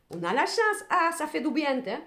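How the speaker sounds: high, loud burst of relief; delighted relief. Real, not acted. Reader turning up the luck card, delighted relief.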